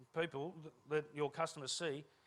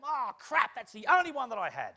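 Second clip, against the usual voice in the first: louder, higher cry of dismay; dismayed outburst; 6 semitones higher, 8 dB louder than usual. Acted. Speaker replays losing his only small-talk line, dismayed outburst. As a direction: blurt it out in mock panic.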